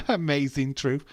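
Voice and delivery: amazing truth laughed out loud; laughing. Real, not acted.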